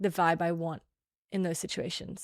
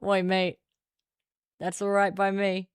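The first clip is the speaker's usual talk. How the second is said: put-on a touch louder, broad Australian accent; 4 dB louder than usual. Showing how the glasses' accent talks, broad Australian accent. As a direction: lay on the Aussie accent, matey. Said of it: "The accent says".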